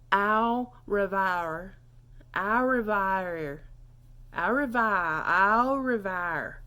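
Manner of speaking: "au revoir" slow as ow re-vye-er; rolling vowels demo. Shown as a demonstration. Teacher practising goodbye with learners, slow rolled demo. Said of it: "the O and the I roll off your tongue".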